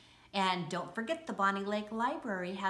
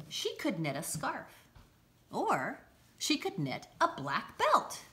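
lively picture-book read-aloud; bright, bouncy storybook narration; reading to children. Acted.

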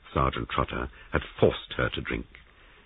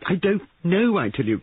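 high, loud outburst; frantic protest; distressed cry; 5 dB louder than usual. A narrator's reading. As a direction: cry out under pressure, at the end of your tether. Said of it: "cried Molly".

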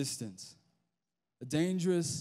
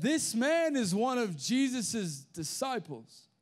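higher, drawn-out accusation; accusing; 7 semitones higher, slower than usual. Acted. Girl pointing out Peter by the fire, accusing. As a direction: call him out, slow and high-pitched.

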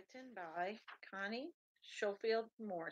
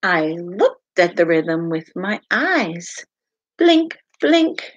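lively, sing-song picture book read-aloud; animated storytime voice with playful sound words; reading to children; 2 semitones lower, 25 dB louder than usual. Acted. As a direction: read it out to little listeners with bounce.

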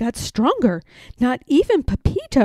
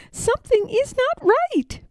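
put-on higher child's voice, uneasy; small girl sensing trouble; little girl Madeline. A narrator's reading.

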